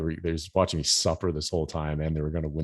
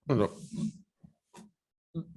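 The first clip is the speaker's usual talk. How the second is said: a choked, higher little no, no; high strained refusal; 8 semitones higher, 5 dB quieter than usual. Retold or imitated. Body rejecting the butter, a strained high no, no. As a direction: gag it back like your throat won't let it down.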